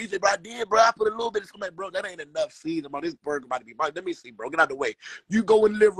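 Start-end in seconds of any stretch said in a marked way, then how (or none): 0.2-1.4 pitched-up defensive friend; weak retold excuse; high whiny excuse. Slim quoted defending himself. 1.7-4.9 low-voiced mock authority; low, quiet scolding. Bossy retold reply, low and quiet.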